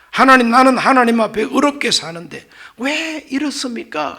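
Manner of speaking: high, loud appeal to God; acted protest. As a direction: protest your righteousness, stretching the why. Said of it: "하고 항변하는 것은".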